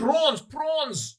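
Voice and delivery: very high mock South African accent; bad South African impression. Asking if co-host saw the film, South African accent. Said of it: "I did a bad impression of him".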